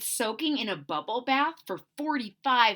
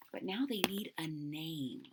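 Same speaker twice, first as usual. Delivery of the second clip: whisper; 6 semitones lower, 12 dB quieter than usual. Real, not acted. Storyteller whispering to listening kids. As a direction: drop to a secret whisper. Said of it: "storyteller whispering aside".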